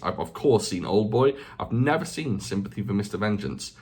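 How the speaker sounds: ordinary delivery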